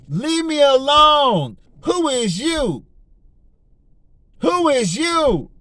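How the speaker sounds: high, slow mocking brush-off; taunting the devil. Preacher telling Satan to leave, high mocking taunt. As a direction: rub it in, big mad. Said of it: "That's what we need, we need to be telling the devil".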